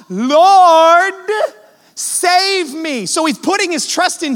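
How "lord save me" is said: a sinking man's high, loud plea; crying out for rescue. Retold or imitated. Peter sinking, crying out to the Lord for rescue, high and loud. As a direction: call out to the Lord to save you. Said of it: "we just heard Peter cry out".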